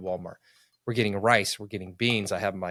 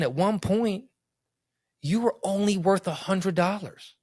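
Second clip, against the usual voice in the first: gentle self-comfort; 9 semitones higher than usual. Retold or imitated. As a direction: talk yourself down gently, like consoling a friend. Comforts himself, retold self-talk.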